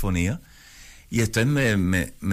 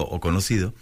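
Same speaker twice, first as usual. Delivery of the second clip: ordinary delivery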